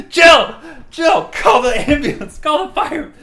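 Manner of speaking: manly yelling. Acted. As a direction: bellow for help across the house. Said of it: "My manly voice. it must carry for miles".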